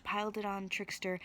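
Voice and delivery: plain reading voice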